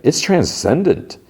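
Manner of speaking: slow, high-pitched rave; awed praise. Retold or imitated. Friend judges the played-back audition, slow high-pitched awe. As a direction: stretch out the praise, pitch lifted.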